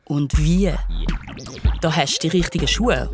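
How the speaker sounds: usual speaking voice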